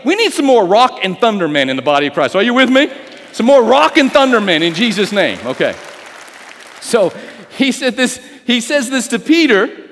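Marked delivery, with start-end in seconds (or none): 0.0-5.4 higher, louder preacher's call; preaching at full force. Acted. Preacher rallying the congregation, loud and high. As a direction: rouse the church.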